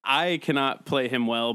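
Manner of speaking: ordinary talk, nothing marked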